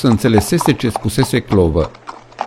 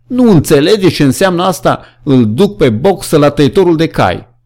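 horrified cry; 6 semitones higher than usual. A narrator's reading. Benjamin reveals horse slaughterer.

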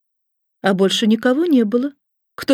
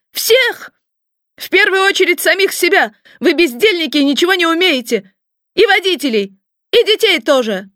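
high, piercing, outraged cries; boss's furious tirade; 7 semitones higher than usual. A narrator's reading. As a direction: rant shrilly, firing off each order. Boss fires everyone in a rage.

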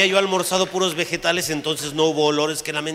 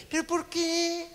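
high nervous question; 14 semitones higher, 3 dB quieter, slower than usual. Retold or imitated.

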